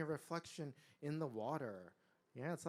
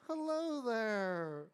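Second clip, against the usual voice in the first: drawn-out, higher friendly greeting; trying to be friendly; 7 semitones higher, 6 dB louder, much slower than usual. A narrator's reading. Tiger greets a dog, trying to be friendly. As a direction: stretch the hello out in a big friendly call. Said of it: "Trying to be friendly".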